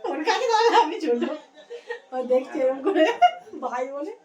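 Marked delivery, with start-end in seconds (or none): 0.0-1.2 laughing. Real, not acted. Laughing beside the tall boy.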